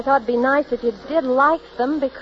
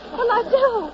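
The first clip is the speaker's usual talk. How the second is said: panicky repeat; 12 semitones higher, slower than usual. Acted. Train pulls out with her aboard.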